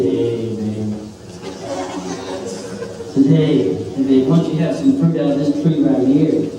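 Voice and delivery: a low, smooth tempter luring Eve; sly snake. Acted. Snake sweet-talking Eve into eating fruit, low and sly. Snake voiced in Bible story.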